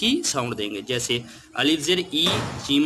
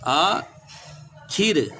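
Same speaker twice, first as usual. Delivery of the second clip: slow drawn-out word; 3 semitones higher, much slower than usual. Shown as a demonstration. Teacher to pupils, stretching the word very slowly. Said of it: "फिर है आखिर".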